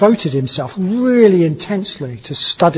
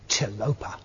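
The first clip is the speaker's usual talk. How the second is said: whispered name; 6 semitones lower, 10 dB quieter than usual. Retold or imitated. Hag names his future teacher.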